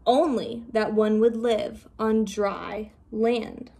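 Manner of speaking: plain reading voice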